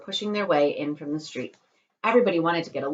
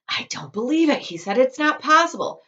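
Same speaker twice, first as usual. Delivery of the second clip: disbelief; 9 semitones higher, 7 dB louder than usual. Acted. Father in disbelief.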